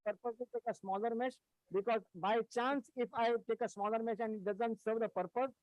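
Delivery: faint, far-off voice from a weak mic; words barely reach the listeners; barely audible. Real, not acted. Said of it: "your voice is very low".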